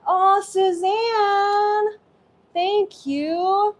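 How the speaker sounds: high, singsong, drawn-out delight; thrilled, stretched-out thank-you; gushing thanks. Real, not acted. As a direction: coo the name long and high with gratitude. Thanks another viewer for support.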